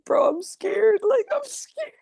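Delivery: very high, nervous admission breaking into a laugh; half-laughing frightened squeak; mock scared. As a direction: squeak out that you're scared, then laugh at yourself. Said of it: "And I was like".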